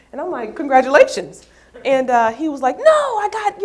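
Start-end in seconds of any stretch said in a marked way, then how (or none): 0.5-1.5 cheerful congratulations. Retold or imitated. Retold reply to a friend. 2.8-3.6 protesting. Retold or imitated. Friend's protest, retold.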